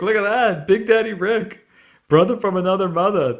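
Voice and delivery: thrilled cheer over the card, high and loud; real delight, voice jumping up; excited. Real, not acted. Reading the card from Rick with a thrilled shout.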